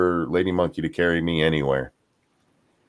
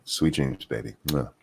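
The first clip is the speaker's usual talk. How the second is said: put-on soft, low goodnight to a child; sweet voice; 7 semitones lower, 6 dB quieter than usual. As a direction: tuck him in gently. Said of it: "With your sweet voice".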